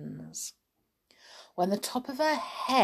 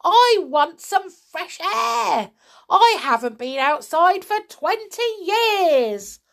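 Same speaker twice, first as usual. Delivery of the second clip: defiant shout; 9 semitones higher, 6 dB louder than usual. A narrator's reading. Grandma insists on going outside, defiant shout. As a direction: shout it with greedy relish. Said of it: "she shouted".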